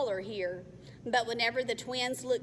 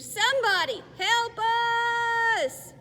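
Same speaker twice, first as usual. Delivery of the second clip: calling for help; 13 semitones higher, 6 dB louder than usual. Acted. Calling for rescue.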